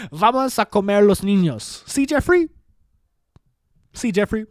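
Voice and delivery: mock politician wheedling in Spanish; Xavier Barrera. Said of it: "I think Xavier goes".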